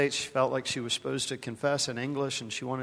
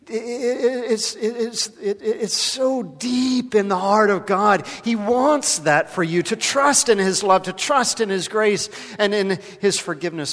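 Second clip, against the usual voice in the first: voice breaking; 8 semitones higher, 9 dB louder than usual. Real, not acted. Preacher overcome telling the congregation of God's love, voice breaking. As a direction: let the feeling choke the words. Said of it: "emotion overtakes preacher".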